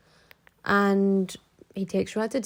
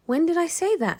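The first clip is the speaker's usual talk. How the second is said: higher, faked surprise; mock innocent denial; 9 semitones higher than usual. Boyfriend denying the promise to his girlfriend, higher mock shock. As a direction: play the liar feigning shock.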